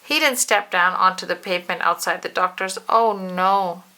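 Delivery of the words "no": usual speaking voice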